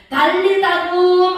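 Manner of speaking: slow, loud, high commanding call; solemn command. Acted. Jesus commanding the dead girl to rise, slow and loud. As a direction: call her up with authority.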